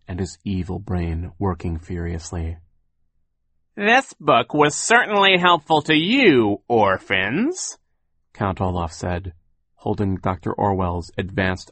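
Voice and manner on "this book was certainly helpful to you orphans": high, loud, slow villain voice; gloating villain. A narrator's reading. Count Olaf gloats at the orphans with the book, slow and menacing. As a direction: taunt them, drawing the words out.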